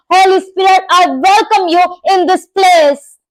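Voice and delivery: loud, high, fervent calling; fervent preaching. Real, not acted. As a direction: cry out to the Spirit.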